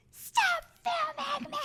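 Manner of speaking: put-on very high, drawn-out toddler wail; mock a crying child's shriek; imitating screaming toddler. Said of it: "she was crying and screaming".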